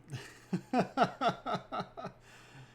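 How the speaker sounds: long, loud, high laughter; high laughter in a long rapid string; amused laugh. Real, not acted. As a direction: crack up at a comment. Laughing at Autumn's comment.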